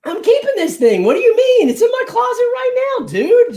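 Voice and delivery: very high, incredulous outburst; indignant protest. Real, not acted. Collector accused of planning to flip it, loudly indignant. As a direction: squeal your defence as if wrongly accused.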